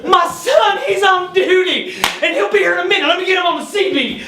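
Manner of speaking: put-on high, excited little old lady voice. Old lady paramedic to injured driver at her door, high-pitched fussing. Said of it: "this little old lady opens the door".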